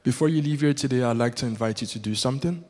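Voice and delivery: ordinary delivery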